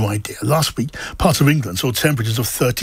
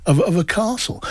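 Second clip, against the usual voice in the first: ordinary talk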